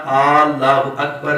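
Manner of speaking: اللہ begun with a drawn-out 'Aaa'; wrong long first alif. Shown as a demonstration. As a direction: stretch the first A of اللہ, as 'Aal-laahu'. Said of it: "یہ دو الف بن جائیں گے". (اللہ کا پہلا الف لمبا کر کے)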